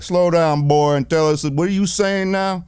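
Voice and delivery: a hint of a mocking Southern lawman.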